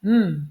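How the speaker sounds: M as a long hummed "mmm"; letter sound. Shown as a demonstration. Teacher showing parents the sound of a letter, M hummed. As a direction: hum the sound of M, not its name. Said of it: "je vais utiliser le son".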